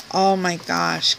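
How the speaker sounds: normal talking voice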